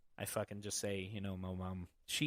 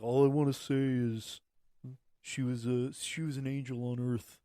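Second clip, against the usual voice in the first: a hint of a tough Italian guy, louder; Stallone-like stepfather; 4 dB louder than usual. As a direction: lightly mimic the stepfather's gruff eulogy. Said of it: "he sounds like, uh, fucking Sylvester Stallone".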